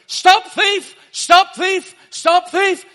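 full-force high-pitched street shout; loud, high open-air cry. Retold or imitated. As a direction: bellow it to halt a whole crowd. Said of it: "I just kept shouting".

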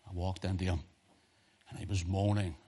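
usual speaking voice

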